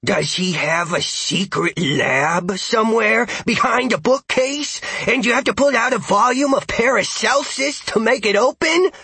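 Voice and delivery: high, eager rising questions; excited speculation. A narrator's reading. Dog guesses wildly at his human's secret lab, excited. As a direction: spin a wild theory with mounting excitement.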